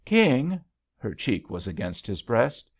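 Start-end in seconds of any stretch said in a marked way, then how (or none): 0.0-0.6 high, loud startled cry; note of alarm. A narrator's reading. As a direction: call the name in sudden alarm. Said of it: "came a note of alarm".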